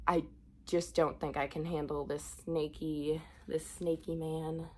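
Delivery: usual speaking voice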